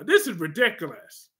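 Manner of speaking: usual speaking voice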